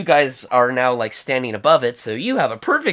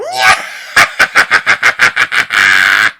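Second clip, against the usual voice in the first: loud, rapid creature cackle; monkey cackle; 4 semitones lower, 10 dB louder than usual. Acted. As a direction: cackle wildly like a little monkey. Little monkey cackles at the side.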